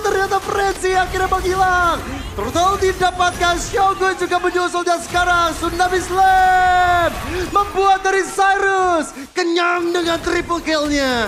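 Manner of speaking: fighting game announcer. Acted. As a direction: call the teamfight like an announcer. Live play-by-play hype. (gaya komentator pertandingan yang bersemangat)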